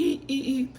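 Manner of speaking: high, thin squeaking noise; imitated squeak. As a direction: mimic a little squeaky sound. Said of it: "I heard a little squeaky".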